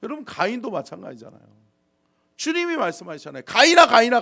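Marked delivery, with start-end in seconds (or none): 3.5-4.2 loud, high summons; ringing cry to someone astray; God calling out. Acted. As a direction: call the name urgently.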